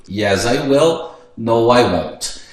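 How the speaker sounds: slow, carefully spaced English answers; slow teaching demo. Shown as a demonstration. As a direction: model each word slowly for beginners. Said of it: "ele já começa a fazer aqui o futuro". (respostas em inglês bem devagar, didáticas)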